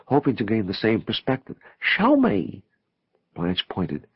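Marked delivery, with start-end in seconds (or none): 1.8-2.6 high, urgent plea; eager demand. A narrator's reading. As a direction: beg to be shown, pitched up. Eager to share the vision.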